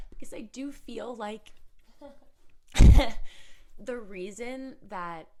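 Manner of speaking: laughing while choking up; fighting tears with a laugh; emotional laughter; teary laughing. Real, not acted. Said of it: "now I'm gonna cry".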